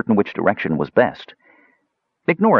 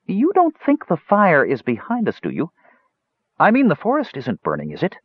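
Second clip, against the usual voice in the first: frightened voice pitched much higher; 10 semitones higher than usual. Acted. Nervous dwarf asking Tanis about the fire, pitched high. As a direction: let the voice climb up in panic. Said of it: "his voice was pitched half an octave higher than normal".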